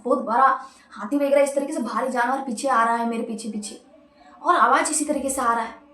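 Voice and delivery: plain reading voice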